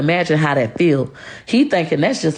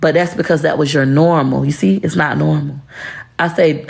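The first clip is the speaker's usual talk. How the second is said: ordinary talk, nothing marked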